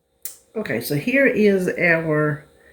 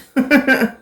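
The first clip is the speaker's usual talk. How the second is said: short high laugh; 8 semitones higher, 4 dB louder than usual. Real, not acted. Laughs at own food quest.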